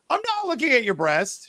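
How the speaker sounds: high-pitched mock protest; indignant denial. Man denying he stared at her, indignant. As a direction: imitate a wounded, insistent denial.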